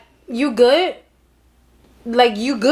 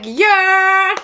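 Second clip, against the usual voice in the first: drawn-out yeah; 6 semitones higher, 3 dB louder than usual. Shown as a demonstration. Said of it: "if you be like".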